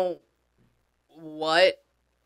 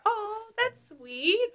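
high, slow, softer coo; touched; 5 dB quieter, much slower than usual. Real, not acted. Medium touched by brother at the party, tender coo. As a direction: coo it high and slowly, moved.

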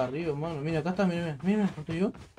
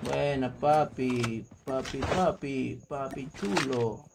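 slow, low stretched vowels; slow, crooned approval; drawn-out praise; 4 semitones lower, 4 dB quieter, much slower than usual. Real, not acted. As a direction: stretch out each word lazily. Praising teammate in drawn-out words.

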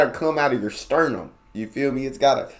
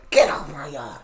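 lightly voiced grumpy granddad; a hint of a cranky old man. Grumpy granddad to kids playing on his yard, lightly grumpy. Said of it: "a grumpy granddad who just never finds joy".

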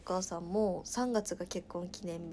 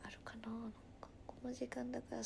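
whisper; 13 dB quieter, faster than usual. Real, not acted. Searching her photos.

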